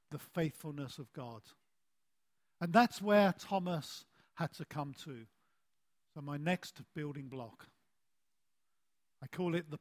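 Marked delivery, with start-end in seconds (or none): none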